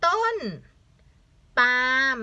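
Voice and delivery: stretched, separated ต้น ... ปาล์ม; syllable-by-syllable reading. Shown as a demonstration. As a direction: sound out ต้นปาล์ม in two beats. Teacher sounds out the word.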